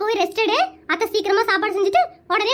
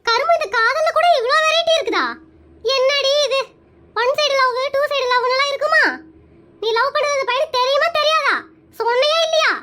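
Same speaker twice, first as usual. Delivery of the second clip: high-pitched, scolding incredulity; exasperated disbelief; 5 semitones higher than usual. Acted. Aunt baffled by one-sided love, exasperated and high. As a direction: quiz her as if it makes no sense.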